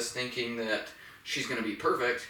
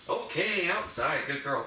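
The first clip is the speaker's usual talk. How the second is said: "outside" set apart and pushed, pitched up; emphasis on outside; 4 semitones higher than usual. Shown as a demonstration. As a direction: stress "outside" in a higher-pitched cue to the dog. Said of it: "I'm gonna emphasize the word outside".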